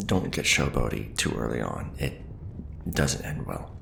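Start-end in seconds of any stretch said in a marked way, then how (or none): none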